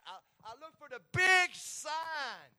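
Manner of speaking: high, loud and slow; drawn-out shouted line; emphatic punch line. Acted. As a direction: belt it out for the congregation.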